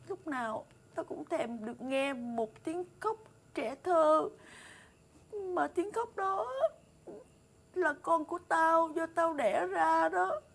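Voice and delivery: slow, drawn-out, aching confession; wistful longing. Acted. Mistress confiding to her maid her wish for a child, wistful longing. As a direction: linger on each phrase, yearning.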